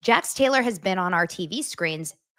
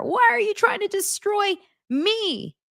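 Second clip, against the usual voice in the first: a higher, mock-whiny Jax; mock him in a higher whine; imitating Jax; 12 semitones higher than usual. Jax yelling at his ex, mock whiny voice. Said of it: "he's saying this to her".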